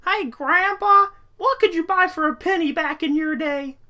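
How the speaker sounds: put-on kid voice. Comedy sketch, kid asks grandpa.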